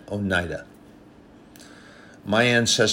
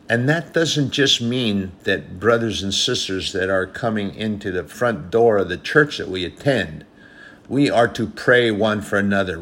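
louder and louder, driving the point home; rising sermon preaching; 5 dB louder than usual. Acted. Preacher urging the church to pray for leaders, building fervent preaching. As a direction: preach it to the congregation with growing force.

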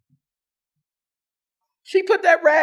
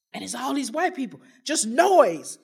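ordinary delivery

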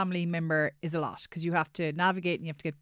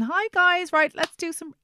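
bright, much higher and louder class greeting; peppy instructor; 11 semitones higher, 6 dB louder, slower than usual. Retold or imitated. Fitness instructor greeting her class, high and upbeat. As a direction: bounce in like a fitness teacher pumping up the class.

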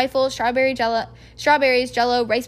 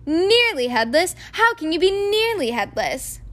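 put-on curious boy; 6 semitones higher, 3 dB louder than usual. A narrator's reading. Seamus voiced.

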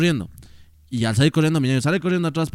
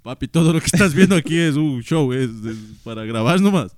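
mock plea to dad; 2 semitones higher, 5 dB louder than usual. Podcaster jokingly addresses his dad through the camera, loud and teasing. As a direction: talk to dad over the camera, half joking.

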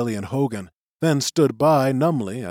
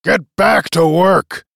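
loud, slow, angry command pitched up; growled order; 6 semitones higher, 9 dB louder, slower than usual. A narrator's reading. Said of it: "he growled".

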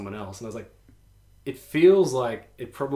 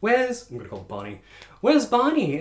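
mildly voiced owners; 9 semitones higher, 3 dB louder than usual. Owners asking for dog.

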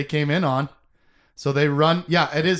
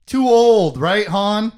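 loud teasing jab; 5 semitones higher, 8 dB louder, slower than usual. Real, not acted.

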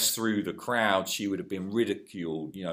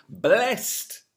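preached beatitude; 6 dB louder, much slower than usual. Retold or imitated. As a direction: proclaim it slowly with force. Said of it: "he said this".

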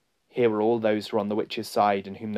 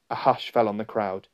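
plain reading voice

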